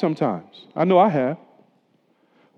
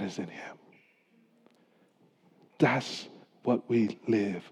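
hushed preaching; 7 semitones lower, 7 dB quieter than usual. Acted. Pastor to congregation, hushed reverent preaching. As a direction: draw the congregation into awe quietly.

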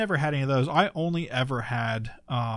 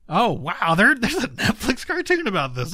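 higher and louder, amazed; retelling a delighted discovery; excited surprise; 9 semitones higher, 6 dB louder than usual. Retold or imitated. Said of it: "I was like".

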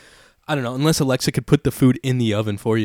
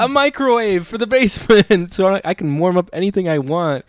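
high, loud, triumphant announcement; gleeful reveal; 7 semitones higher, 5 dB louder than usual. Real, not acted. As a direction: show off a new toy, thrilled. Triumphantly reveals his new toy.

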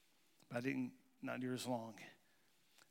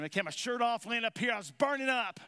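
excited; 7 semitones higher, 6 dB louder than usual. Real, not acted. Confessing heated story climax.

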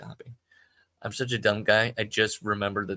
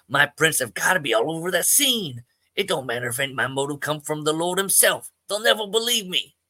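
paranoid; 6 semitones higher, 5 dB louder than usual. Acted. Paranoid Joe pleading.

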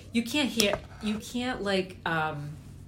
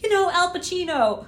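mock insistence; 11 semitones higher, 9 dB louder than usual. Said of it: "but I'm like".

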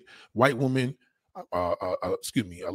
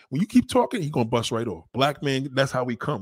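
normal talking voice

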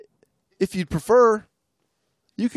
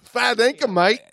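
higher mock Australian accent; 9 semitones higher, 3 dB louder than usual. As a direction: fake Aussie, higher pitched. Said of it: "our accents aren't gonna carry us".

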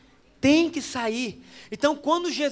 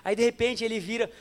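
usual speaking voice

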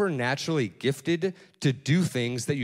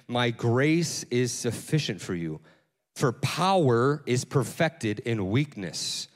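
scripture reading; 4 semitones lower, slower than usual. Acted. Reads scripture aloud.

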